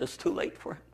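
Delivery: hushed, breathy words of bad news; a ranger softly delivering terrible news; grave near-whisper. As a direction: say it barely above a breath.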